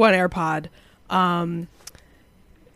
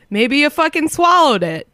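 put-on Boston accent; 5 semitones higher than usual.

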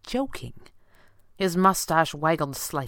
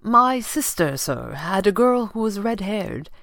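higher, slightly awkward butler voice; 5 semitones higher, 4 dB louder, slower than usual. A narrator's reading. Butler explaining himself to his employer, suddenly awkward. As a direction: explain yourself with stiff politeness. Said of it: "suddenly awkward".

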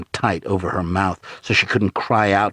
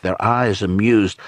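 ordinary delivery

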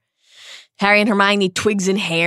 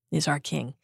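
ordinary talk, nothing marked

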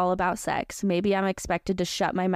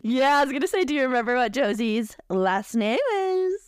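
excited; 6 semitones higher, 3 dB louder than usual. Real, not acted. Host excitedly confirms the twist.

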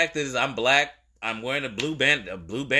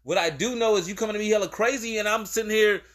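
much higher, incredulous retold challenge; worked-up retelling; 8 semitones higher than usual. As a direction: replay the standoff all fired up. Retelling his own confrontation.

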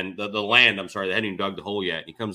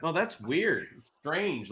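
playing dumb; 5 semitones higher, 6 dB quieter, slower than usual. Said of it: "I'm just playing dumb".

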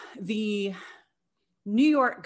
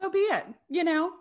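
playful high; 10 semitones higher than usual. Acted. Echoing mock declaration.

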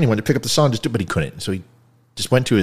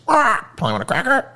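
high squawky parrot voice, much higher; mock parrot squawk despite promising not to; 13 semitones higher than usual. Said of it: "I'm not gonna do the parrot voice".

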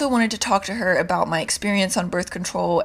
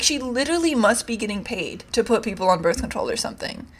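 normal talking voice

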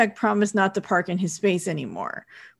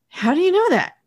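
incredulous question; 9 semitones higher, 3 dB louder than usual. Retold or imitated. Reporter questions editor's uncanny guesses, incredulous. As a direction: ask it higher, in mock bafflement.

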